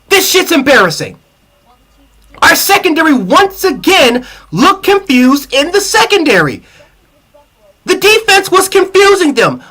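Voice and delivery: exasperated fan ranting high and fast; heated rant. Real, not acted. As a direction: vent the frustration at the defense. Angry rant about the secondary.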